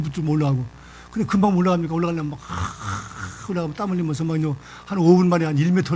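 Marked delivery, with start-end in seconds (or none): 2.5-3.5 slow, breathy climbing pants; imitated straining gasps. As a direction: huff as if hauling yourself up a rock. 힘겹게 암벽 오르는 흉내. (힘겹게 헉헉대는 흉내)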